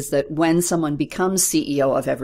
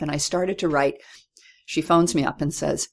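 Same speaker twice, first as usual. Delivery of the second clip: ordinary delivery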